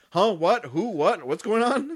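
high-pitched, bewildered questions; baffled. Retold or imitated. Old security guard during a robbery, mock bafflement. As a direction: play an utterly confused old guard. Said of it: "he looked baffled".

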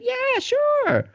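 put-on high squeaky old lady voice, falling; lady at the bakery answering the dad, in a high voice; mock old lady. The bakery lady brushing him off, high and light.

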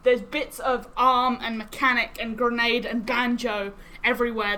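ordinary talk

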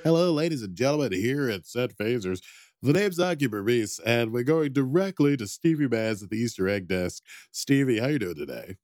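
loud, fast game-show host intro; hammed-up TV announcer welcoming viewers. Mock show host opening.

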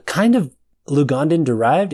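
usual speaking voice

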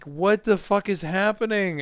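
high-pitched mock bewilderment; retold bewilderment. Patient waking to see another surgery, mock bewildered alarm.

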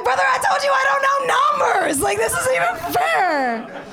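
loud, high-pitched protest, words stretched out; exasperated wail. Acted. Injured patient protesting to the EMT's card, exasperated wail.

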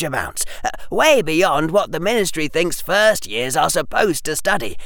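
persuasive, earnest schoolboy. Acted. Harry urges Hermione to join the armies, keen and persuasive. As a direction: win her over with eager, pressing arguments.

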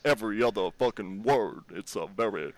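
accent every other word, Virginia style. Shown as a demonstration. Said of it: "you get serious with the fucking, you just accent".

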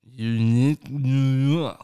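tongue held while talking; tongue-tied twister, said slowly; holding tongue. Shown as a demonstration. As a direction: say it with your tongue held, slow and loud. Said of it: "Hold your tongue and do it".

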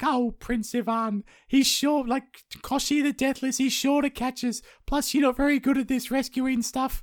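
very high squeaky damsel; princess voice. Retold or imitated. Maya Marevna scolds Ivan in a squeaky high damsel voice.

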